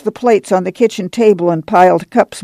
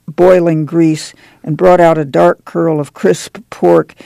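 plain reading voice

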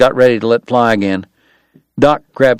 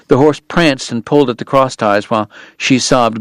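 usual speaking voice

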